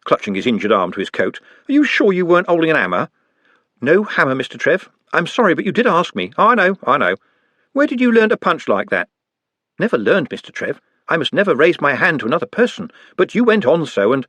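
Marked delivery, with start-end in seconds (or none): 1.7-3.1 higher, pained street-lad voice; a pained city lad; Trev in pain. Acted. Trev voiced in read story. 3.8-14.2 put-on light, polite young goblin voice; gently earnest helper; a lightly voiced polite goblin; Nutt's voice. Nutt voiced in read story.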